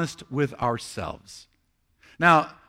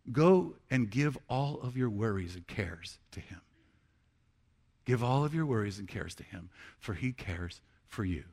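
whisper; 8 semitones lower, 10 dB quieter than usual. Acted. Quoting Peter's tender advice.

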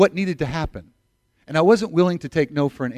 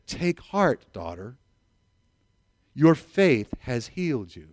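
slow, gentle reassurance; tender comfort; 5 semitones lower, slower than usual. Retold or imitated. Jesus comforting the woman.

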